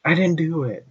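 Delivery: high-pitched mock child voice; little kid. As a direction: imitate a little kid protesting innocence. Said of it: "you hear a little kid going".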